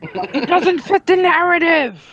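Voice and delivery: mock objection. Co-host parroting a political argument after the Nazi joke, mocking.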